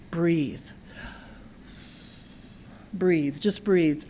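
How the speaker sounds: slow, soft and soothing; calm slow reminder. Shown as a demonstration. Mother reminding herself to stay calm, slow and soft. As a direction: breathe it out slowly, calming yourself.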